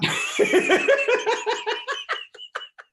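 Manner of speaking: high-pitched, breathy laugh; laughter. Real, not acted. Host laughing at co-host refusing Title on his side, high laughter. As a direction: burst into high, drawn-out laughter.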